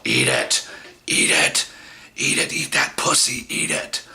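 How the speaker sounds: imitating a guttural, half-voiced demand; guttural woman. Date pushing his head down, guttural mock demand. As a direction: growl it like a possessed command. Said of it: "she changes her voice to, like, it, she almost sounds gutter".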